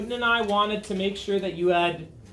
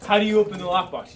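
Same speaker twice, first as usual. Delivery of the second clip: faster and louder repeat of the question; rephrased question; 6 dB louder, faster than usual. Real, not acted. Teacher re-asks the class, quicker and louder. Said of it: "think out my questions a little bit more".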